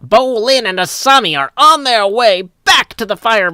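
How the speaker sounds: high, loud mid-Atlantic broadcaster; Legend of Korra announcer. Shown as a demonstration. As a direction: punch the words like a radio announcer. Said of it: "the announcer in Legend of Korra".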